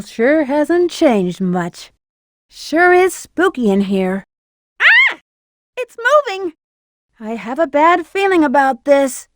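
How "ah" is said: sharp high startled cry; high yelp of shock. Acted. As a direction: yelp in sudden fright. Startled by moving statue.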